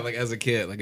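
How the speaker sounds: ordinary delivery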